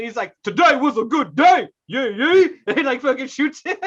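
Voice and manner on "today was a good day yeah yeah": loud, higher, mock rap shout. Rapper bursting in with a machine gun, loud mock shout. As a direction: belt it out like a rapper storming in. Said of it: "then [name] comes out".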